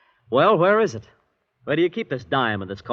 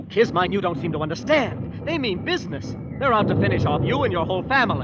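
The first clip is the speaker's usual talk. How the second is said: high, insistent, speeding up; urgent pleading; 9 semitones higher than usual. Acted. John urging Kismine to grasp the danger, urgent. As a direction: make her see the danger now.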